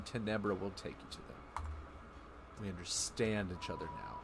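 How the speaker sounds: a hint of a tamed creature pledging help; calmed Tenebra. Voicing Tenebra as ally.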